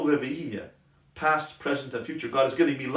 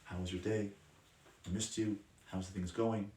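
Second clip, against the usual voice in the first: low, very soft, tender check-in; level one, gentle; 4 semitones lower, 11 dB quieter than usual. Shown as a demonstration. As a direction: speak gently, as if to a spouse. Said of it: "Level one is".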